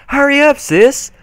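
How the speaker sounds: little sister calling from the stairs, hurry up. Acted. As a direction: shout for her sister to hurry. Apple Bloom calls down stairs.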